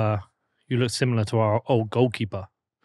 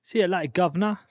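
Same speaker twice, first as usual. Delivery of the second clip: a higher mock Cockney British accent; 7 semitones higher than usual. Guest answering the host's bit, a higher mock British accent. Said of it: "Show me your British accent".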